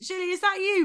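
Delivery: high, searching call. Acted. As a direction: call a friend's name across the room, unsure. Calling out to someone among strangers.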